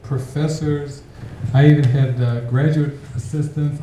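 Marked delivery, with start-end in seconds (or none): none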